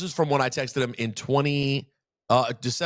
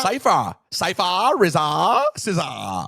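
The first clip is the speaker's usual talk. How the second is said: Cypher, rizza, scissor all ending in ah, higher and louder; mock New York accent; 8 semitones higher, 5 dB louder, slower than usual. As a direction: tease the friend by copying his New York endings. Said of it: "New York accent".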